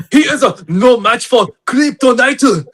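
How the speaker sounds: high, loud, hammed-up samurai villain; mock samurai voice. Co-host taunts Superman as anime villain, mock samurai voice. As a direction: declare it like a cartoon samurai in a put-on accent. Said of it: "sound like a samurai".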